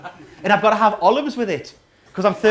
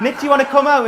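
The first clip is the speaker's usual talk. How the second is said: eager, higher and louder call; excited invitation; 5 semitones higher, 6 dB louder, faster than usual. Retold or imitated. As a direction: call up to your mate all keen.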